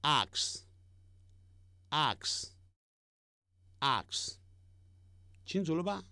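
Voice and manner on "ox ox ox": ox stretched very slowly, round o; slow model of ox; drawn-out ox. Shown as a demonstration. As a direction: hold the o in ox long. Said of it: "公牛".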